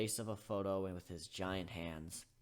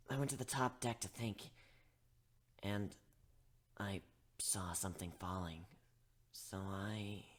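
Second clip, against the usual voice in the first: hushed, secretive whisper; whispered confession; 5 dB quieter than usual. Acted. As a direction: confess under your breath. Gilda confessing in the hall.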